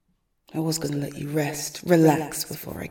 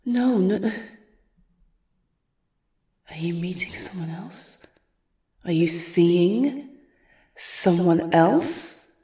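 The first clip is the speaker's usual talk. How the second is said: jealous anger; 7 dB louder, slower than usual. Acted. Jealous stalker overhears call.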